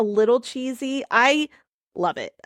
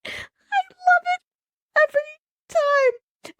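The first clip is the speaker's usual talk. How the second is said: way up high and slow, almost crying with glee; swooning over a favorite movie line; squealing delight; much slower than usual. Real, not acted. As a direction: gush over it like a giddy fan. Gushing over favorite Punisher line.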